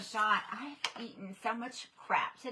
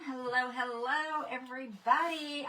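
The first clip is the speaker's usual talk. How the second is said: ordinary delivery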